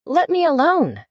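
high-pitched, mildly indignant order. A narrator's reading. Alice tells the attacking Pigeon off, mildly indignant. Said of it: "said Alice indignantly".

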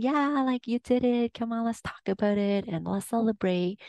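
high, excited cheer that eases toward the end; imitated cheering coach. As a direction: cheer them on like a pom-pom-waving coach. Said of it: "showing me this blue pom pom, waving it at me and saying".

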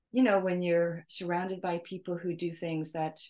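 ordinary talk, nothing marked

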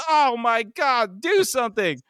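high, drawn-out cry for help; panicked yell. A narrator's reading. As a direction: stretch out a high-pitched acted yell. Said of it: "Huey yells".

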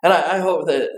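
ordinary talk, nothing marked

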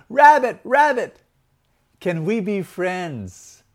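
high, loud hopeful call, slowing into the question. Acted. Storyteller's elephant hails the rabbit, calling out high. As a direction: call across to someone, drawing out the plea. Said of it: "the elephant called".

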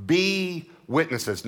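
"be" held long and high before "witnesses"; stress on be. Shown as a demonstration. Preacher drilling the word into the congregation, stress on "be". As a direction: punch "be" high and stretch it out. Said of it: "notice the word be".

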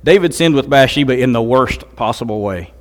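ordinary talk

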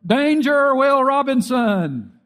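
high, loud, slow robot alarm call; Lost in Space robot. Retold or imitated. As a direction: blare it out like the TV robot. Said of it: "most memorable line out of Lost in Space".

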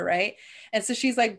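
usual speaking voice

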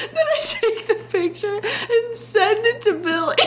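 high, slow, giggling through the words; laughing through words. Real, not acted. Telling the photo prank, high and laughing. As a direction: laugh your way through the line.

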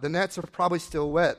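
usual speaking voice

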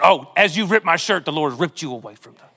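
a lightly hammed-up prophet declaring judgment; dramatic prophet voice. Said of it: "that's a good pastor move".

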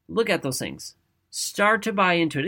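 normal talking voice

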